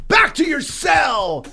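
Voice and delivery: high, drawn-out mock shout; shouting prison guard. Guard orders prisoner from the hallway, mock shout. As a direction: holler it slowly like a guard clearing the hallway.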